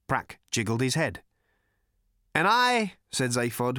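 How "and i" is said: high, loud, self-important lead-in; grand announcement. A narrator's reading. Zaphod introduces himself to Prack, loudly self-important. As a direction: hold the room before your name. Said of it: "of such gravity to be tossed in lightly".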